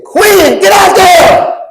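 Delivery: high, loud shout from the sideline; coach yelling. Retold or imitated. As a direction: call him onto the field. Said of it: "he said".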